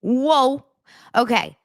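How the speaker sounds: ordinary delivery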